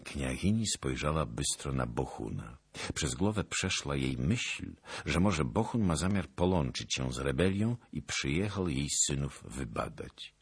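ordinary delivery

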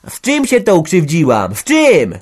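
loud, high vicious abuse; screaming quarrel. Acted. Women hurling insults in a screaming row. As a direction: hurl insults at full voice.

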